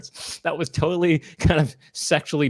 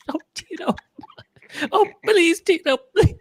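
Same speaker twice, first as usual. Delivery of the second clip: high-pitched mock-desperate voice; hammed-up pleading, building to a wail; pleading stuck man; 7 semitones higher than usual. Man stuck mid-routine hoping Tito hears, mock pleading.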